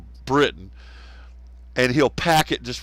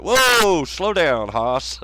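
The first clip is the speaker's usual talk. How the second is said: loud, high whoa, easing down; calling a halt; 5 semitones higher, 4 dB louder, much slower than usual. Acted. Listener to fast-talking Gaz, mock calling a halt. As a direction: rein them in with a big whoa.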